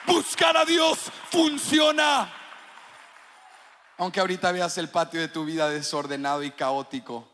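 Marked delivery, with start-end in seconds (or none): 0.1-2.3 loud, high-pitched preacher's proclamation; full-force preaching. Real, not acted. (predicación a toda voz)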